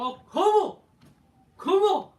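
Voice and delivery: shouted high and loud, hammed up; hammed-up fan shout. Orton imitated whipping up the fans with a hammed-up shout. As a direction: yell it out like a wrestler hyping the crowd. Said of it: "He's just gonna go".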